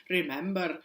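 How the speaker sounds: "Remember" with a flapped Scottish R; slightly Scottish, tapping the R in "Remember"; Scottish accent. Retold or imitated. Student retelling her accidental slip, Scottish-accented "Remember". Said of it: "accidentally sounded Scottish".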